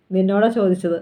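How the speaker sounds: plain reading voice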